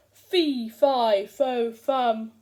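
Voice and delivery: loud booming giant chant; giant yell. Acted. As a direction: bellow it to scare the boy out. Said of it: "I yelled".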